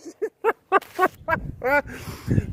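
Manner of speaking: high, breaking-up laughter. Real, not acted. Host cracking up over the botched intro, real laughter. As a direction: crack up helplessly.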